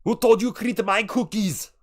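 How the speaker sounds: high, loud mock-Austrian bellow; Austrian accent. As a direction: demand it as an outraged Austrian action hero.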